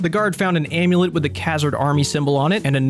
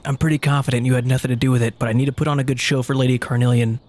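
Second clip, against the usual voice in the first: ordinary talk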